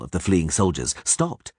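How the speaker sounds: ordinary delivery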